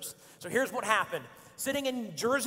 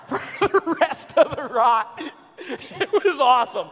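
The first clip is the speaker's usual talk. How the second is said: real laughter breaking into the words; louder, slowed, cracking up at the memory; laughing through it; 4 semitones higher, 6 dB louder, slower than usual. Real, not acted. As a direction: struggle to finish the punchline for laughing. Laughing at own story's climax.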